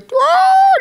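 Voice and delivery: high-pitched imitated wail; drawn-out mock scream. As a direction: mimic a long scream. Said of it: "dia teriak kuat-kuat".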